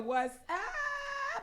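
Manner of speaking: high, squealed greeting to a viewer; excited greeting. Real, not acted. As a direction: pitch it way up like spotting a friend. Greeting viewers on live stream.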